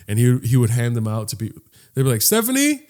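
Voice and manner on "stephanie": high questioning call; calling out a name. Retold or imitated.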